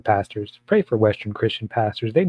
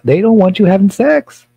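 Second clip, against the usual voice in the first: outraged shout; 8 semitones higher, 11 dB louder than usual. Real, not acted. Outraged rant at church police.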